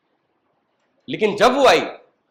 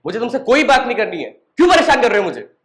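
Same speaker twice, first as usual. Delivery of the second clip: raised, higher-pitched imitation of an annoyed girlfriend; irritated rejection; 7 semitones higher, 6 dB louder than usual. Girlfriend fed up with his calls, acted angry outburst. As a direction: push the caller away, voice climbing louder.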